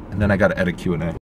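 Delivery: ordinary talk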